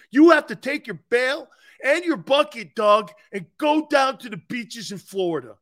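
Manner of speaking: high, loud whiny boss voice; mock the GM guarding his turf like a spoiled kid; mocking Howie. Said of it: "because he told the G M off".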